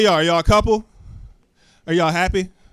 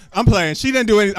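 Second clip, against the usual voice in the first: ordinary talk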